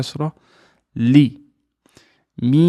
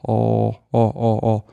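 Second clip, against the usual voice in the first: ordinary talk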